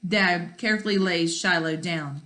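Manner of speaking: ordinary delivery, nothing marked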